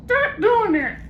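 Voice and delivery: very high parrot echo; mock him back like a parrot; parrot mimicking.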